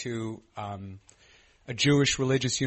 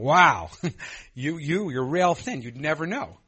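surprised; 4 semitones higher, 8 dB louder than usual. Retold or imitated. Reacting to sponsor's weight loss.

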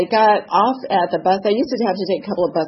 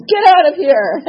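louder dismissive bark; a louder gruff get-out; gruff order; 7 semitones higher, 8 dB louder than usual. Retold or imitated. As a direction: throw her out of the shop.

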